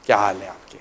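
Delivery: friendly greeting. Shown as a demonstration. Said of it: "سلامی بھیج دو یار".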